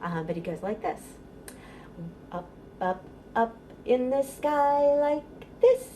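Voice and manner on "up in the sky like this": usual speaking voice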